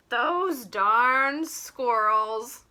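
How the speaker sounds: very slow, fond and affectionate. Acted. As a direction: say it like a grump who secretly loves them. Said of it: "he said fondly".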